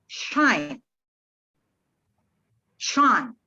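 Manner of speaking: "shine" with a long, clear sh; clear sh sound. Shown as a demonstration. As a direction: stretch the sh in "shine". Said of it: "itu kan sh".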